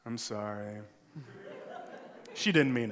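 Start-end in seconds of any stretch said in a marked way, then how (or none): 0.0-0.8 a lightly done grudging sorry, lower and soft; half-hearted fake apology; classic sibling apology. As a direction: mutter a forced, insincere sorry. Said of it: "the classic sibling apology".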